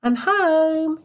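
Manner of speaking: mother calling out as she comes in. A narrator's reading. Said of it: "she called out".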